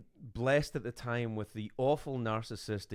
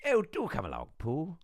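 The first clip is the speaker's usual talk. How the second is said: higher, English owl character voice; Owl from Winnie the Pooh; 6 semitones higher, 3 dB louder than usual. Shown as a demonstration. Showing the hosts his Owl voice, prim English. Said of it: "I'm the owl in Winnie the Pooh".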